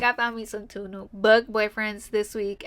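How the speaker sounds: ordinary talk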